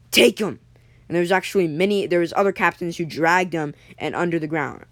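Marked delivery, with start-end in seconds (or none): 0.1-0.6 loud, higher-pitched short order; barked command. Acted. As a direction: order the crew to seize a prisoner. Captain orders prisoner taken away.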